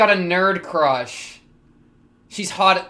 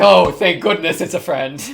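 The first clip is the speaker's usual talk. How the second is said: laughing quote; 10 dB louder than usual. Retold or imitated. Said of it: "She's like".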